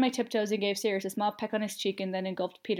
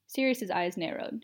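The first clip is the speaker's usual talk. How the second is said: plain reading voice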